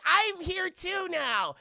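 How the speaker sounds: high, slow, loud put-on voice; hammed-up bright-eyed tagalong; Crystal. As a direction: announce yourself in a drawn-out goofy voice. Acts Crystal announcing herself.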